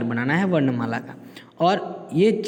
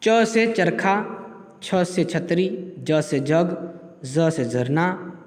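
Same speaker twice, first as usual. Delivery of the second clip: slow dictation; slower than usual. Shown as a demonstration. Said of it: "teacher reading slowly".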